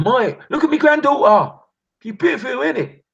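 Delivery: high, loud put-on voice of the old neighbour; squeaky proud granny showing off. Old racist neighbour voiced in bit.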